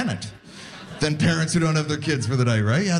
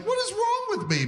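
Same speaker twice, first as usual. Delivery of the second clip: squeaky high, quieter self-disbelief; baffled at himself; 4 dB quieter than usual. Retold or imitated. Comic asking himself why he packs oatmeal, squeaky disbelief. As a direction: ask it like you can't believe yourself.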